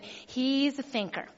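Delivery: normal talking voice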